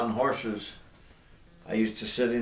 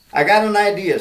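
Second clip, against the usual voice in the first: eager, louder cowboy voice; excited old cowboy; 10 semitones higher, 13 dB louder, faster than usual. Acted. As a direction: announce the plan with a grin. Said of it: "said Al Benny".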